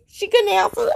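high-pitched mimicked mother; mama in background. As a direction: chime in high like mama from another room. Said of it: "my mama in the background".

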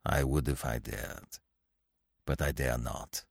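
low, soft, sullen refusal. A narrator's reading. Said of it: "the cloud of sullenness deepened".

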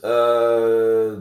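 ordinary delivery

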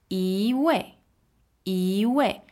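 一 rising in second tone before 位. Shown as a demonstration. Teacher warning learners about 一位, second tone on 一. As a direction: say 一位 with 一 lifted, then 位 falling. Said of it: "It becomes a second tone".

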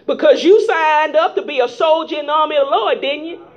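normal talking voice

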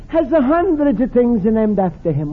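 normal talking voice

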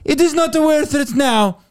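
put-on Marie Curie warning her assistant in a thick Polish accent.

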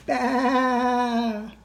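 long, high baa; lamb bleat. Acted. Storyteller voicing the baa blown out of the lamb, long bleat.

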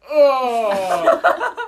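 high, loud startled oh with a laugh; surprised oh. Retold or imitated. To the coach after the girl cries, a loud high startled oh. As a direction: caught out, a laughing loud gasp. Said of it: "I'm like".

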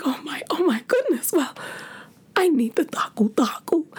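very high whispered swoon. Retold or imitated. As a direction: melt over the phone. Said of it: "I'm flustered".

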